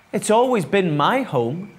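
a touch of wistfulness; lightly sighed lament; sighing. A narrator's reading. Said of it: "sighed Little Eggcorn".

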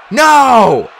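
loud, high scream of no; desperate high shout. A narrator's reading. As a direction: cry out in alarm. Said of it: "I screamed".

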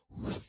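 loud, breath-blown whoosh; imitated whoosh. Shown as a demonstration. Preacher showing the waves obeying, imitated whoosh. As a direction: make the noise of waves swept away.

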